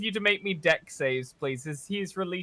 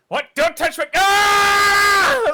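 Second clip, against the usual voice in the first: high, loud panicked yelp; shrieking Husk shocked awake; panicked shout; 11 dB louder, much slower than usual. Acted. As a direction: jolt into a high loud scream. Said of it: "he goes".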